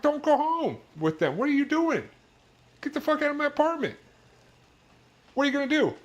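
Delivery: high-pitched outraged rant; exasperated rant. Real, not acted. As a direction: rage in disbelief at the guest. Ranting about the party bus guest.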